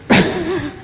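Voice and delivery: quick high chuckle; a brief amused laugh; short laugh. Real, not acted. Amused by his chickadees image, short laugh.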